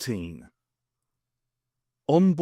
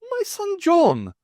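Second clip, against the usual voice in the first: much higher, slow and louder; exasperated; 5 dB louder, much slower than usual. Acted. Jeremy exasperated with the Brigadier, high and slow. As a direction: spell it out in high frustration. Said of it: "equally exasperated".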